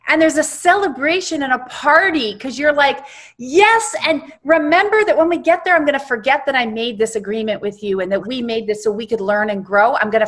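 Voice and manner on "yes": joyful yes. Retold or imitated. Celebrating a soul agreement in heaven.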